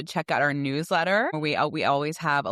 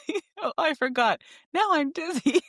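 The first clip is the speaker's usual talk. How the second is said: laughing mock kid; 12 semitones higher than usual. Mimicking son, laughing.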